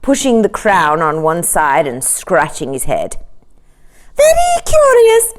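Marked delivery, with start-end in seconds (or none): 4.1-5.3 very high, loud courtier echo. A narrator's reading. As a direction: parrot the king in a high chorus. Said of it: "said the courtiers".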